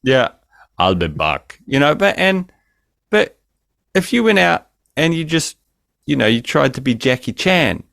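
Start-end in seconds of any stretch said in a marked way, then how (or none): 0.8-1.6 low Austrian one-liner, deadpan; understated Austrian accent impression; Arnie accent. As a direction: lower mock Austrian accent. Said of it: "put on Arnie's accent".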